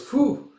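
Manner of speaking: a high, loud little phew of relief; relieved phew. Retold or imitated. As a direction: blow out a mock relieved phew. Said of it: "she goes".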